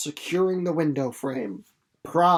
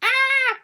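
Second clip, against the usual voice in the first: excited exclaim; 6 dB louder than usual. Real, not acted. Reaction to end of chapter.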